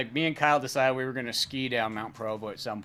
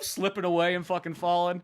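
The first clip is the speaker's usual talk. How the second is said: ordinary talk, nothing marked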